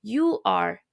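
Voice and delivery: "You are" as two full words; full form you are. Shown as a demonstration. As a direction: say "You are", not "your".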